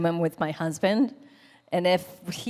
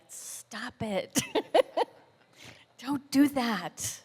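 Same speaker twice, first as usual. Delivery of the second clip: mock scolding; 6 semitones higher, much slower than usual. Retelling scolding her husband, laughing.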